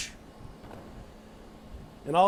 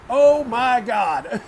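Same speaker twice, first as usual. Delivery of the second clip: stunned exclamation; 2 semitones higher, 6 dB louder, much slower than usual. Real, not acted. Reaction to ladder shot.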